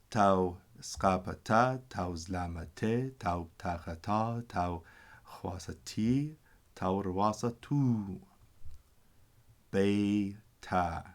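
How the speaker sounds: slow, deliberate reading of each vowel name; slow teacher reading of tau skapata and the vowel list; slow reading drill. Shown as a demonstration. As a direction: read the list slowly like a class drill. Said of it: "reading vowel names slowly".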